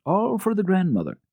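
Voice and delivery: plain reading voice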